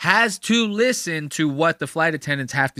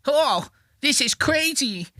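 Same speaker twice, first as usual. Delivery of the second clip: Ed Norton bagel; 5 semitones higher than usual. Retold or imitated. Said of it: "He's doing the Ed Norton from Sausage Party".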